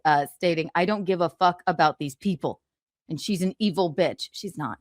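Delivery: ordinary talk, nothing marked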